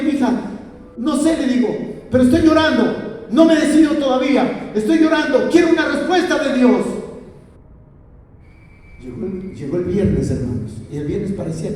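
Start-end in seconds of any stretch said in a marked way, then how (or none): 0.0-6.8 high, loud, impassioned retelling of weeping; retold tearful plea. Husband retells telling his wife he is crying, impassioned plea. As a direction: re-enact a desperate plea, pitched high. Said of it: "Y estaba orando".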